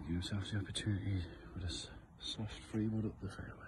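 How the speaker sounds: hushed golf commentary voice; soft, quiet TV golf announcer; golf commentator. Pretend.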